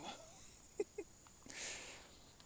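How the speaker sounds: high unvoiced breathy laugh. Real, not acted. Laugh while stalking animals.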